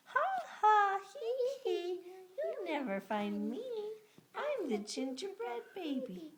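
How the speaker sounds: high gleeful taunting cookie; gingerbread baby voice. Acted. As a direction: tease in a high sing-song. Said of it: "sang at the top of his gingerbread voice".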